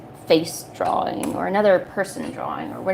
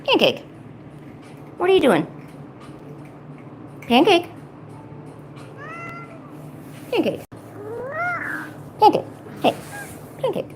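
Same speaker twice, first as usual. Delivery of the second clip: high calling a pet; talking to a dog; 12 semitones higher, 4 dB quieter than usual. Real, not acted. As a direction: call the pet by name.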